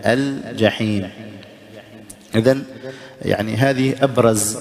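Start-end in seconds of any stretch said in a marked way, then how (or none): 0.1-1.1 «الجحيم» slowly as al-jaheem, distinct l; clear lam. Shown as a demonstration. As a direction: sound the l fully in «الجحيم». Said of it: "اللام مظهرة". («الجحيم» بإظهار اللام)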